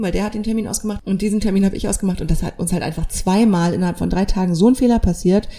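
ordinary delivery

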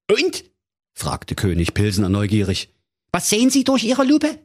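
very high, flustered comic king; a panicking king shouting orders; king losing his head over his missing daughter, high and agitated; flustered King Pilsner. Acted. King curious.

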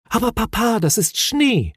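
high-pitched son's voice; high, insistent storybook child; young Moomin, correcting. A narrator's reading. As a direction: set father right in a high little voice.

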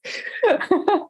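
high cheeky giggle; laughing. Real, not acted. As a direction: giggle off to the side. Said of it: "spitzbübische Art zu lächeln und zu lachen".

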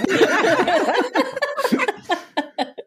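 high, long bursting laugh. Real, not acted. Guest laughs at introduction.

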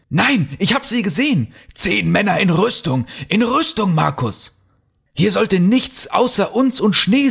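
high, urgent, insistent outburst; frantic panic. Acted. Peter begs Markus to believe him and flee, frantic.

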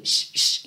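whispered "Sh, sh" hiss; sh sound demo. Shown as a demonstration. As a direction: hiss "Sh, sh" softly with no voice. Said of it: "vai ter um som de X ou de S H". (som de "Sh" sussurrado)

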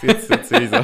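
laughing. Real, not acted. Laughs at friend's silly story.

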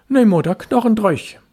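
put-on high, loud quick boyish answer; boy reassuring. Boy assuring his mother his socks are dry, high and quick. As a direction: brush it off brightly.

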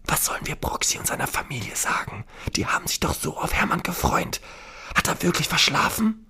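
worried whisper. Acted. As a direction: hush it, worried. Whispering on plane. (besorgt flüstern)

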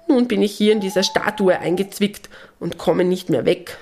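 cheerful imp who got himself stuck; little gnome, pleading. Acted. Gnome stuck in the statue begs Lisabella and the animals to help.

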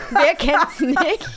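slow, loud, laughing. Real, not acted. Mocking the unfinished title, slow laughing. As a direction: drag it out through laughter.

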